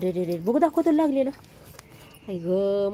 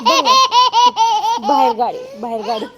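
laughing; 11 semitones higher, 8 dB louder than usual. Real, not acted. Mother laughing, pointing outside.

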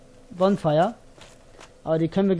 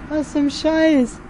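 baffled; 8 semitones higher, 3 dB louder, much slower than usual. Real, not acted. Baffled by strange event.

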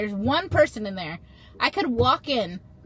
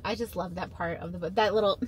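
ordinary talk

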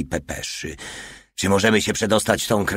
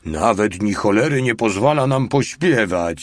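mild aggrieved grumble; a touch of whining protest; complaining. A narrator's reading. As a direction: complain like someone denied his fun. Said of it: "żalił się Mały".

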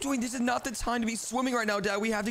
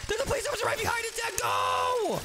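panicked shout. Pretend. Police officer right behind them.

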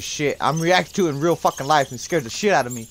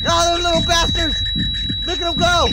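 high, loud alarmed yelling; frantic outcry; 9 dB louder than usual. Real, not acted. Gamer yelling at swarming bugs, high and frantic. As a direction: yell at the scurrying bugs in alarm. Creatures rush him in game.

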